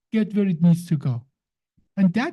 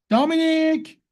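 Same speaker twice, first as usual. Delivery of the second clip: high, drawn-out name call; 9 semitones higher, slower than usual. Shown as a demonstration. Speaker suddenly calls listener mid-explanation, calling out. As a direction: sing out the name for attention.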